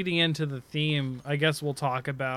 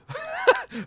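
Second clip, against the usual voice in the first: high-pitched burst of laughing; laughter. Real, not acted. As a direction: crack up at an over-the-top name. Amused by magma arm wording.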